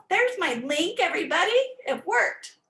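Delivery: high, delighted that it worked; pleased little cheer to the class; excited. Real, not acted. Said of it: "excited link worked".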